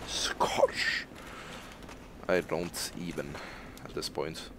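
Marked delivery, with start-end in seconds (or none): 0.1-1.1 whispered Scottish-accent attempt; soft, voiceless mock Scottish accent. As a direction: hush Scottish in a put-on Scottish accent. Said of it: "Just doesn't seem quite right".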